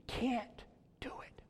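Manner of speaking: slow hushed whisper. Acted. Preacher confessing our helplessness, slow whisper. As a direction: breathe it out slowly.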